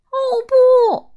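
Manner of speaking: very high, loud squeal of shock; shrieking alarm. Acted. Miser finding tomato ears, high shocked shriek. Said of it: "他惊呼道".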